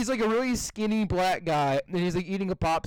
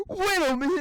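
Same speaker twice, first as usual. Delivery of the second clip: high-pitched mock-British surprise; imitated British guy; 9 semitones higher, 3 dB louder than usual. Retelling the British guy meme, high mock-British voice. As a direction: mimic a baffled Brit, pitched up. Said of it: "it's a British guy".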